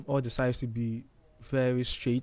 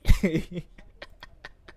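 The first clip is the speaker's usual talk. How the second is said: real laugh at her own joke; cracking up after the punchline; burst of laughter; 5 semitones higher, 6 dB louder than usual. Real, not acted.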